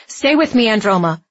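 loud, desperate urging; urgent plea. A narrator's reading. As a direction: plead to keep someone conscious. Dex urges her to stay awake.

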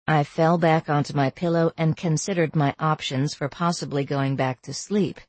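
usual speaking voice

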